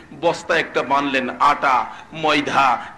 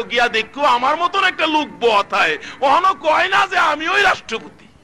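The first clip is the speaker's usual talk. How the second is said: rising to a high cry; impassioned acted speech; 10 semitones higher than usual. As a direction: build from raised voice into a high preacher's shout. Umar's speech, acted climax.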